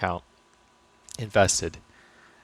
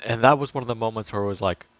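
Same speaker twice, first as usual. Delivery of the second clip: usual speaking voice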